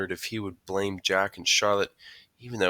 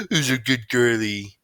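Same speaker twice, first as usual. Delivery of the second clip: slow, raised, louder doggy-praise voice; dad voice; 4 semitones higher, 7 dB louder than usual. A narrator's reading. Boy praises his dog Daisy, putting on dad voice. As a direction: coo to the dog like a dad, drawn out. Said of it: "I said in my dad voice".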